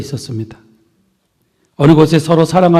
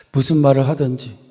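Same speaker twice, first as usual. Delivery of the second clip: usual speaking voice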